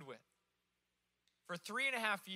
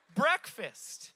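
Breakfast. said higher and louder with a falling finish; punchline repeat; 4 semitones higher, 8 dB louder than usual. Real, not acted. Pastor landing the punchline to the congregation, loud.

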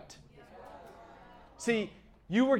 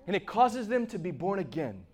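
normal talking voice

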